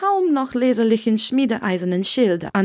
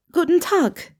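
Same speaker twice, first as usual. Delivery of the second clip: lighter, higher voice for Hermione, polite; young Hermione voice; 9 semitones higher than usual. A narrator's reading. Hermione at the cottage door, lifted young voice.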